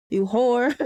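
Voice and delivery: ordinary talk, nothing marked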